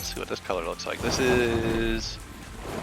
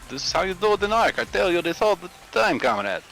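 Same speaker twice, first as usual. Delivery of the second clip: higher-pitched put-on Russian accent; mock Russian; 6 semitones higher than usual. Gamer explaining Ark breeding to comrade, mock Russian accent. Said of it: "And then we became Russian".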